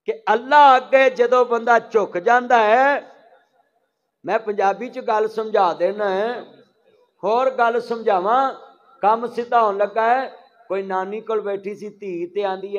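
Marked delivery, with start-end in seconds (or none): 0.1-10.3 louder, higher pulpit delivery; raised-voice preaching. Real, not acted. Preacher explaining to his congregation in Punjabi, raised preaching voice. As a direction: drive the point out to the whole congregation.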